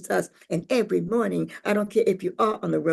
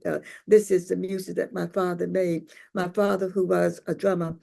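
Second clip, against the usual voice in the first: plain reading voice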